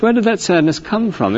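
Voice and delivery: ordinary talk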